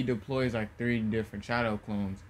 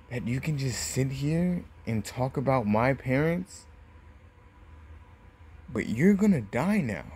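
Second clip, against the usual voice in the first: bitter threat. Acted. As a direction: hold back between phrases, punching the key words. Naruto threatens Gaara over his parents.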